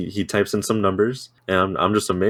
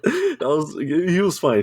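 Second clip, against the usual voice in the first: laughing; 8 semitones higher, slower than usual. Real, not acted. Still laughing after shocking line.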